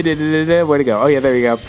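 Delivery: ordinary talk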